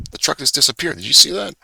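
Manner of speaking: suddenly high and loud amazement; real astonished exclaim; surprised. Real, not acted. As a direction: blurt it out in real disbelief. Amazed at vanishing truck.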